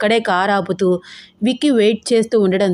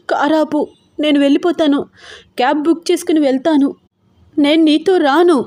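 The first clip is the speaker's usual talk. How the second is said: tearful shout; 7 semitones higher than usual. Acted. Wife to her husband in the car, shouting through tears. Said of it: "అరిచింది".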